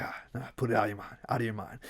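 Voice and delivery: soft, fast self-talk; muttering to himself. Acted. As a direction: brush the thought off under your breath. Talking himself out of it.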